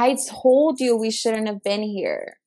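normal talking voice

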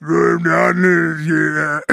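lightly voiced lumbering giant; Andre the Giant voice.

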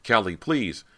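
high, loud plea; desperate begging. A narrator's reading. Brant shielding himself from Callie's hatchet, desperate begging. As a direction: beg for your life. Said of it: "Brant begged".

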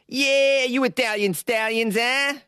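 hammed-up Italian wiseguy with a long opening "yeah"; mock Italian wiseguy. Host hailing Italian patrons, hammed-up Italian mobster voice.

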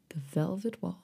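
hushed, lingering over the words; soft sultry repeat. Shown as a demonstration.

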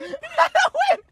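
high laugh through the words; laughing squeal. Real, not acted. Brother echoing the joke, high and laughing.

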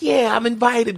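eager acceptance. Acted. Host imagines Glenn in a normal scene, mock eager. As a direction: pipe up as the invited nerd.

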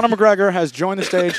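fight commentator. Pretend. Commentating an imaginary fight, excited announcer voice. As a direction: announce a surprise entrant. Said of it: "they start commentating it".

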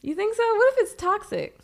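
fearing the foam is toxic; worried. Real, not acted. Worried dog ate foam.

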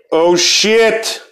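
high, loud excited shouts; excited yelling. Real, not acted. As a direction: yell it out in thrilled surprise. Vinegar pour overflowing, excited yelling.

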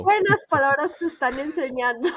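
high, loud, breaking into laughter; teasing through laughter. Real, not acted. Guest teasing host about the rude word, laughing. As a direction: tease him while cracking up.

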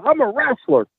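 high, indignant declaration; hot-headed. Retold or imitated. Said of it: "I'm hot. I done got hot".

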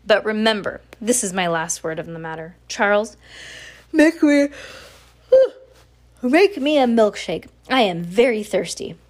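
a spoiled boss barking for a milkshake; loud grumpy orders. A narrator's reading. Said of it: "Sir said grumpily".

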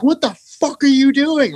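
high, slow outraged yell; mock the furious manager catching you; angry tour manager. Tour manager catching him drinking, high outraged mock yell. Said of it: "He's like".

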